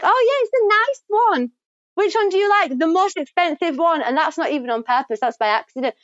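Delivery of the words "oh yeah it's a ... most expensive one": high, breezy shop chat; lightly voiced shopping friend; playful shopper. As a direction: toss it off like a posh shopper. Acting shopping banter.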